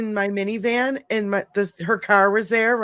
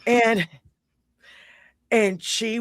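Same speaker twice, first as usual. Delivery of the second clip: ordinary delivery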